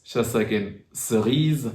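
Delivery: ordinary talk, nothing marked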